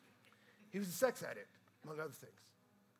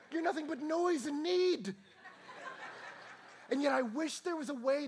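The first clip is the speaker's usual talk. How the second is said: loud, high impassioned preaching; preaching at full force; 13 semitones higher, 14 dB louder than usual. Acted. Pastor to congregation about his baby, impassioned loud preaching. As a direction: pour it out to the congregation.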